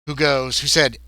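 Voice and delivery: ordinary delivery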